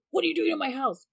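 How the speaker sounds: high, indignant mock yell; imitating husband screaming. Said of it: "he just starts screaming".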